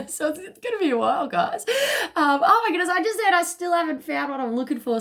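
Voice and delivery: high loud excited laugh and shout; delighted by the song reference. Real, not acted. To viewers about the U2 song, laughing loudly.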